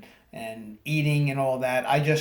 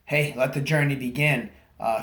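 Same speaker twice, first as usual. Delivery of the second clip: ordinary talk, nothing marked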